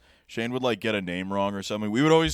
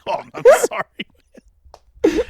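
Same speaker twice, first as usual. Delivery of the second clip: laughing apology; 11 dB louder, much slower than usual. Retold or imitated. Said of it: "I was like".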